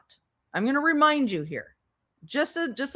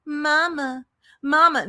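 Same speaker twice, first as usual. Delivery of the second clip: mock toddler calling; 6 semitones higher, slower than usual. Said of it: "you phrased it like".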